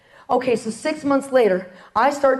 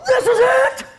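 much higher, laughing burst of joy; thrilled cry; 12 semitones higher, 4 dB louder, slower than usual. Retold or imitated. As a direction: leap up shouting for joy. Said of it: "I jump and I go".

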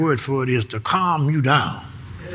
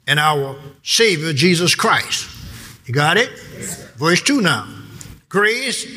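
ordinary delivery

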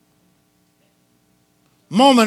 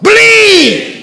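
loud, high, drawn-out cry; high-pitched pulpit shout; preaching shout; 12 semitones higher, 8 dB louder than usual. Real, not acted. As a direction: shout it out to the congregation. Climax of call-and-response preaching.

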